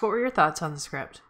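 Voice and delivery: ordinary delivery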